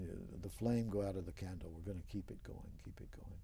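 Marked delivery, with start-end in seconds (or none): 2.8-3.4 whispered echo. Real, not acted. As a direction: whisper it back, almost to himself. Repeating a vow, fading down.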